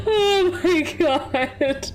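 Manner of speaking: high, slow laughing gasp; drawn-out giggly wail; through laughter. Real, not acted. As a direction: drag it out while cracking up. Laughing at own voice.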